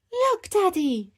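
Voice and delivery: high, slow, loud wonder; high-pitched excited gasping call; gasped amazement. Acted. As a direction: draw it out like an awestruck child. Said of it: "gasped Peppa".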